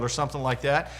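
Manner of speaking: ordinary talk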